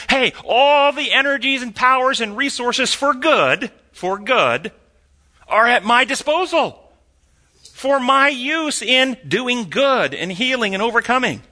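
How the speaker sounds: high, drawn-out rallying claim; hammed-up confident believer, pitched well up; emphatic declaration. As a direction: proclaim it slowly with high, ringing emphasis. Voices a believer claiming heaven's resources.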